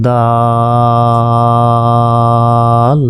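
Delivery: দাল held long in a chanting tone; long recited dal; four-count stretch. Shown as a demonstration. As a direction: draw দাল out over four beats. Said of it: "চার আলিফ টান".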